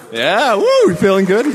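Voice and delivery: high, loud whooping call to the room; hyping the crowd. Real, not acted. Host rousing the conference crowd, high and loud cheer. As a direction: pump up the audience with a big whoop.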